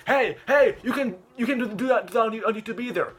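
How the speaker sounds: high, loud mock police call-out; scolding interruption. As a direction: barge in with an acted telling-off. Acted officer interrupting to scold.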